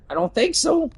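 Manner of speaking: high mock voice; high character voice. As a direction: tease in a squeaky voice. High voice teasing.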